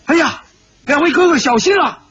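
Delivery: high, quick warning shout; alarmed cry. A narrator's reading. Shi Potian warns his two brothers of knives, crying out in alarm. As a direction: blurt out a high-pitched warning. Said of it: "忍不住叫道".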